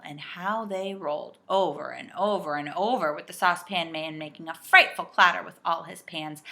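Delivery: usual speaking voice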